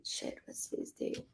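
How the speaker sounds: whispered aside to herself; breathy whisper under her breath. Real, not acted. Muttering the question to herself.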